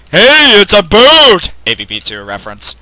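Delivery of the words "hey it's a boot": high loud gleeful yell; triumphant cry of discovery; excited shout. Real, not acted.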